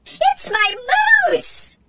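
very high squeaky voice bursting with pride; proud excited high voice. A narrator's reading. Tin can robot showing Jesse its toy, proud high squeak. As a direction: show off your toy like a proud tot. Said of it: "high-pitched voice full of pride and excitement".

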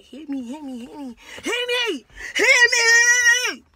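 building from loud to a very loud high yell; escalating shouted taunt. Retold or imitated. Daring the boy to fight.

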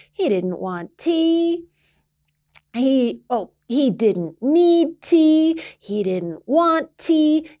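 huffy refusal of tea; grumpy refrain. A narrator's reading. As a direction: drag it out like a grumbling old man.

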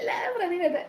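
high laughing outburst. Real, not acted. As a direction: laugh through the name. Bursts out laughing at comment.

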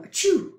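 high, loud acted sneeze; fake sneeze.

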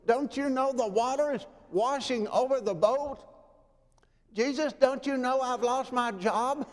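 high, pleading questions to Jesus; a troubled believer crying out to Jesus; desperate plea. Acted. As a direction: beg him to notice, rising each time. Pleading voice of sufferers.

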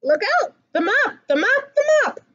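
ordinary delivery

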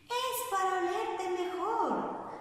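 the false voice keeps slipping; nervous wolf in a false voice. Acted. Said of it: "respondió el lobo nervioso ... voz falsa".

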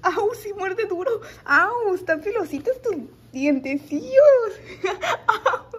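high, laughing mock pain; laughing, playful ouch. Real, not acted. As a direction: giggle through the bites. Puppy biting fingers.

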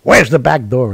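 loud joking alarm; a manager looking for an escape; loud mock alarm at a crowd of questioners; mock panic. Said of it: "And he goes".

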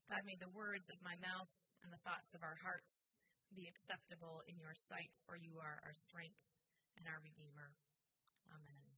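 reverent, subdued prayer. Real, not acted. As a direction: pray quietly, easing down to a hushed amen. Leads opening prayer.

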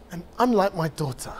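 measured poetry recital with long pauses; spoken-word performance, rising and falling with grief; spoken-word poem. Acted. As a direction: perform it like a slam poem, weighing each line.

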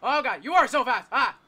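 high, loud panicked screaming; terrified desperate yelling; panicking gamer. Real, not acted. Caught by fast monster.

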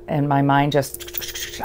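ordinary talk

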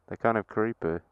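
usual speaking voice

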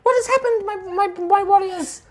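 very high, loud tiny royal in alarm; high-pitched fairy. Acted. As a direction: stammer it out high, like a bewildered little fairy. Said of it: "kind of high-pitched voice".

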